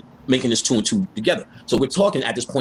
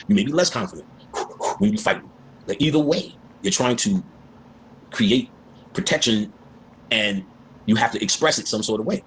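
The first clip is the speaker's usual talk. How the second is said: usual speaking voice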